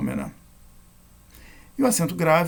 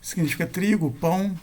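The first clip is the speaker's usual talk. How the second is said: ordinary talk, nothing marked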